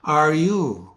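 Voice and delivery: slow, drawn-out caterpillar question; very slow storybook caterpillar; hookah-smoking caterpillar. Acted. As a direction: stretch each word like a languid caterpillar. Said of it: "the caterpillar smoking a hookah saying".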